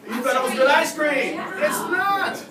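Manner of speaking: laughing. Real, not acted. Teasing the audience about ice cream, laughing. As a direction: tease them through a laugh.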